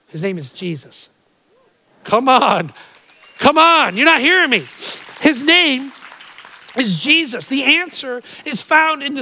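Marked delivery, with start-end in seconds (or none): 2.0-2.7 loud call to the crowd; rally the room; urging. Real, not acted. Preacher urging his congregation, loud call. 3.4-4.0 louder, higher, full-force call; urging harder. Real, not acted. Preacher pressing his congregation, louder and higher. 4.0-7.5 loud, high, emphatic proclamation; preaching hard. Real, not acted. Preacher proclaiming Jesus to the congregation, loud and emphatic.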